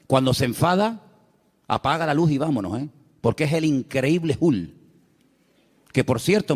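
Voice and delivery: ordinary talk, nothing marked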